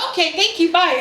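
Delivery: high, brisk mock-polite sign-off; hasty goodbye. Guest hangs up on the operator after hearing of ghosts, hasty goodbye. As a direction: end the call in a high, hurried voice.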